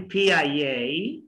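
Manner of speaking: slow P I A as "phee-yai-yay"; drawn-out P I A with Y glides; say after me. Shown as a demonstration. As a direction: stretch and link P I A. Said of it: "try to say after me".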